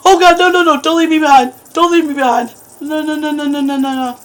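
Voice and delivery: high, loud, frantic begging; panicked pleading. Real, not acted. Left alone in the dark, begging his friends to come back in terror.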